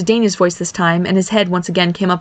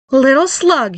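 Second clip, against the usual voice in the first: higher, mildly angry threat; threatening; 7 semitones higher, slower than usual. Acted. Barry rounds on his little brother, threatening. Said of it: "he started to threaten".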